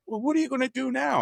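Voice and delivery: very high voice for the wife; imitated wife. Wife asking husband what comes next, very high imitated voice. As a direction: lift the question far up, imitating her. Voices wife's question again.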